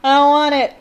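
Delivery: half-joking loud refusal; mock wail. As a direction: wail it in playful dread. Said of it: "I was like".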